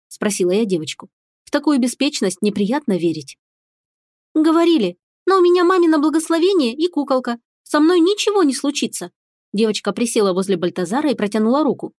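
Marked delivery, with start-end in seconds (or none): 4.3-9.1 put-on high, earnest little girl; a small girl talking. A narrator's reading. Girl answers the witch.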